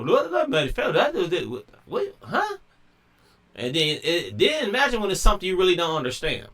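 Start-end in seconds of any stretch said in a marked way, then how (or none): 0.0-2.6 mock confused. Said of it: "and I'm like".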